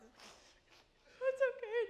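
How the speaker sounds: put-on very slow, high small child saying it's okay; a hint of a sweet little boy. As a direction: play a tiny forgiving kid. Four-year-old son forgives dad.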